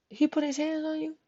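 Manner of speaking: soft, high-pitched question; retold quiet incredulity; hushed disbelief. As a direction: imitate a friend gently checking, appalled. Friend asks her, disbelieving.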